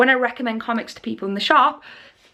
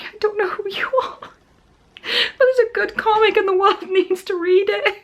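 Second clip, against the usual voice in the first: laughing embarrassed; 8 semitones higher than usual. Real, not acted. Addressing unknown caller, laughing.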